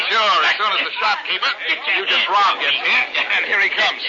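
ordinary delivery

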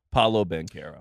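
low name announcement; low-pitched, matter-of-fact self-introduction; Paolo Banchero. Retold or imitated. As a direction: imitate an athlete saying his own name in a deep voice. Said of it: "full culmination moment of him saying his".

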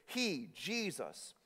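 high, loud, building proclamation; preaching at full force. Acted. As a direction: proclaim the scripture with rising fervor. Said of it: "He says this".

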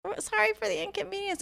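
put-on high, soft apologetic girl voice; mock teen usher. Mocking the usher apologizing to the crowd, high and soft. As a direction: imitate a meek teen apologizing. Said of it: "she's like".

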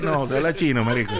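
ordinary talk, nothing marked

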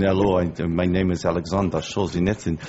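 a hint of a Russian accent; lightly voiced Russian accent. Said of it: "I just did a fake Russian accent".

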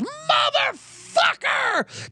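drawn-out high raging scream; furious yell. Retold or imitated. [name] raging alone in the elevator, slow screamed curse. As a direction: explode like a man wrecking an elevator. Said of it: "flips his fucking lid".

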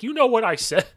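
high-pitched, defiant retort; defiant comeback. Retold or imitated. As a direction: throw it right back at the ref. Coach talks back in retold quarrel.

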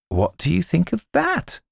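high, taunting goblin. Acted. Goblin shows Gabriel a picture.

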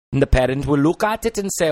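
quick Welsh narration; Welsh accent. Shown as a demonstration. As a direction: hurry it along in Welsh lilt. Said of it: "Welsh dialect".

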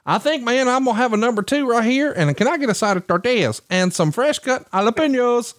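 high hammed-up hillbilly ordering lunch; mock Alabama twang, pitched well up; Southern accent. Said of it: "why do you say it like that".

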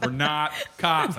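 slow, drawn-out "not cops"; half-speed, insistent disclaimer on "cops"; emphatic. Real, not acted. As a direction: stretch "not" and "cops" to hammer it home. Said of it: "I can't stress that enough".

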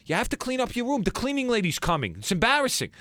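mother scolding son, pitched high; nagging mom. Retold or imitated. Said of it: "she'd be like".

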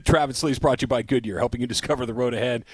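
brisk radio billboard read; sponsor ad read. Acted. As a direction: rattle off the sponsor line low and fast. Reads a sponsor tag.

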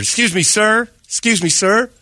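high, polite hailing voice; imitated eager door-knocker; missionary calling out. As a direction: politely hail a stranger in a high voice. Voicing missionary calling to him.

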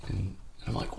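ordinary talk

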